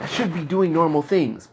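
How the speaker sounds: ordinary talk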